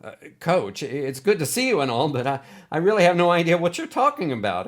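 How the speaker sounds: high, fast, tripping over the words; panicked stammering excuse; nervous stammer. Acted. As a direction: babble it out, scared stiff. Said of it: "Bug stammered".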